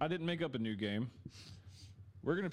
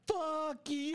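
screaming food; 13 semitones higher than usual. Pretend. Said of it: "if you're about to eat a hot dog, it's like".

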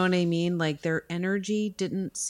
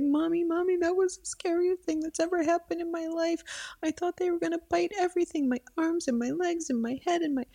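put-on much higher, rushed little-girl voice; shaken child; 11 semitones higher, faster than usual. Mother imitating daughter after the scare, high rushed child voice. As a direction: gush it out like a frightened kid. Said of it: "she was like".

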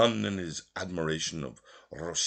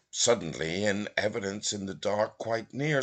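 plain reading voice

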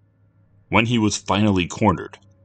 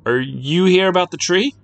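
put-on nervous boy; 11 semitones higher, 5 dB louder than usual. A narrator's reading. Nervous boy addresses strangers.

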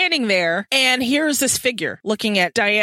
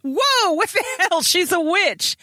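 much higher, startled mock outburst; mock taken aback; 9 semitones higher than usual. Matthew taken aback by Kit, high startled mock. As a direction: jump back startled, pitched high.

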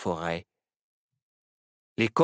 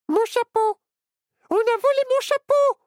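much higher, louder shocked outcry; sudden exclamation; 7 dB louder than usual. Acted. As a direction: cry out as the hat is gone. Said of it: "l'adjointe du shérif s'exclama soudain". (s'exclame soudain, très aiguë et forte)

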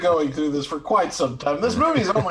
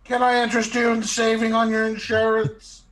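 higher, louder salesman pitch; pushy upsell, higher and louder; upselling cashier; 8 semitones higher, 5 dB louder than usual. Pretend.

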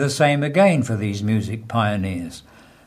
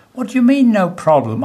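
high, louder acted disbelief; incredulous; 9 semitones higher, 4 dB louder than usual. Doomed prisoner challenging his calm companion, acted incredulity. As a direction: throw it back in mock astonishment.

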